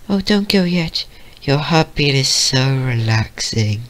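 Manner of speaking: drowsy, drawn-out plea in a small high voice; Fluttershy. Acted. Fluttershy voiced, resting on Vio.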